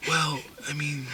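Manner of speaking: breathy whispered reply; movie whisper. Retold or imitated. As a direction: hush it like a solemn film line. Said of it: "people whispering".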